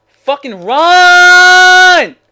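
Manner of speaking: high, drawn-out furious scream; real gamer rage. Real, not acted. Gamer screaming at the game, enraged. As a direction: scream it, holding the last word long. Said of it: "after raging that hard".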